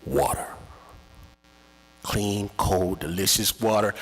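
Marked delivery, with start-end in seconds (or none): none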